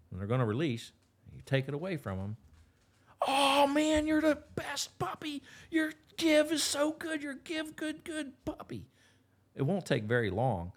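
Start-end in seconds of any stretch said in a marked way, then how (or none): 3.3-8.9 put-on very high, excited baby-talk praise to the puppy. Shown as a demonstration. Trainer praising the puppy for bringing the sock, squeaky gushing. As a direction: gush over the pup in a squeaky voice. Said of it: "you can't overdo the praise".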